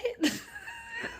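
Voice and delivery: high disbelieving laugh bursting out; a shrieking incredulous laugh; burst of high laughter. Real, not acted. Laughs retelling the shock.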